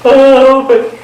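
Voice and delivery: mock whimpering lament, high and slow; tearful complaint. Young student complaining to the teacher, mock tearful and high. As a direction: imitate her young heartbroken self pleading. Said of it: "I was all teary-eyed and quiver-lippy".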